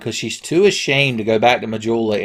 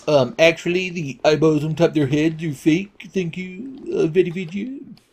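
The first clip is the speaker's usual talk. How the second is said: nerdy know-it-all; 5 semitones higher than usual. Retold or imitated. Mocking a know-it-all commenter.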